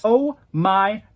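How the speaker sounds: ordinary delivery, nothing marked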